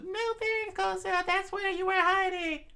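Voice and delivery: very high falsetto cry; high-pitched acted scream without much volume. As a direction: call out in a high princess voice. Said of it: "she screamed out to Koza".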